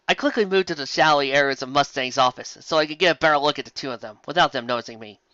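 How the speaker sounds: normal talking voice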